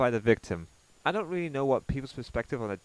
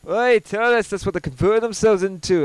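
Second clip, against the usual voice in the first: mock rant; 7 semitones higher, 9 dB louder than usual. Mocking the ranter, yelling.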